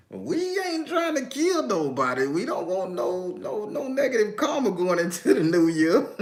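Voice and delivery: high-pitched, laughing protest; amused protest. Real, not acted. Amused protest at doom candle suggestion.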